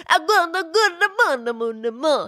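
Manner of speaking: a high mock Italian accent, hammed up. Host imitating Kevin Kline's Italian accent, hammed-up. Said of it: "how he's like".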